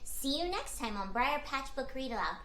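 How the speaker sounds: usual speaking voice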